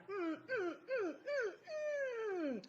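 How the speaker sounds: high, soft blissful humming; blissful hums. Pretend. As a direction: hum like you're melting with pleasure. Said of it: "I'm blissing out".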